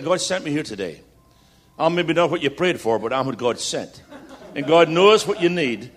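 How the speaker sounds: usual speaking voice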